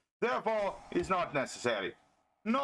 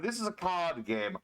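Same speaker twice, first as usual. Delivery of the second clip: usual speaking voice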